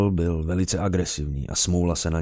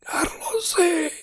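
very high, rasping unearthly whisper; eerie whisper; much slower than usual. A narrator's reading. Dark presence calling Carlos, eerie dry whisper. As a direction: call like something that never spoke before. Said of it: "Ozvalo se ze tmy šeptem".

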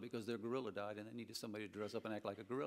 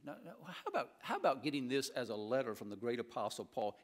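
ordinary talk

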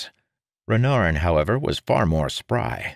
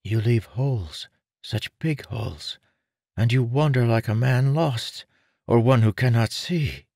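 put-on young man voice; 7 semitones higher than usual. Renarin criticizes.

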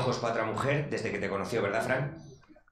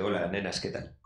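pickup line; 5 semitones lower, 5 dB quieter than usual. Retold or imitated. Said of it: "mi frase estrella para ligar".

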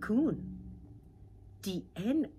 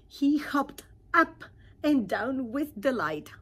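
delighted narration; 7 semitones higher, 9 dB louder than usual. A narrator's reading. Narrating joyful frog moment.

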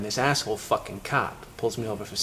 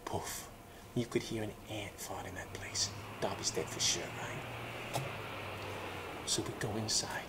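whispered, much softer; hushed whisper; 5 semitones lower, 17 dB quieter, slower than usual. Retold or imitated. Telling his friend about the silent house, whispering. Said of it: "it gets real quiet".

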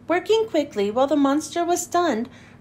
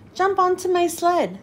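a hint of a hearty prospector, a bit higher; lightly voiced prospector; 4 semitones higher than usual. A narrator's reading. Prospector urging the friends onto his sled, lightly voiced. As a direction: call out cheerfully, lightly in character. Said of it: "said Cornelius".

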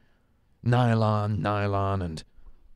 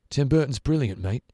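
put-on higher British accent; 5 semitones higher, 4 dB louder than usual. Imagined British man repeating his praise, in a higher, louder British accent. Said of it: "a British man, uh, being like".

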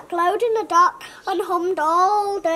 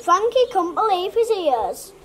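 ordinary delivery, nothing marked

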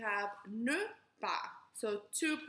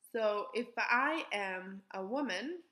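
ordinary talk

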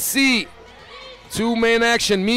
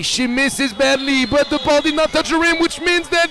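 high-pitched, urgent live call; excited play-by-play; 7 semitones higher than usual. Real, not acted. Commentator calling a late miss to viewers, excited and high. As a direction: carry the excitement up and keep it there.

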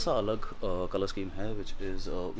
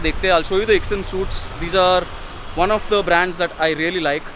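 loud; 5 semitones higher, 9 dB louder, faster than usual. Real, not acted. Vlogging loudly outside the store.

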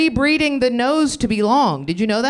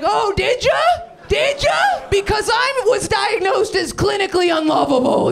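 sarcastic shout; 11 semitones higher than usual. Retold or imitated. Mocking his sister on the phone with a high sarcastic yell. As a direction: scream the sarcasm back at her. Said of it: "And I was like".

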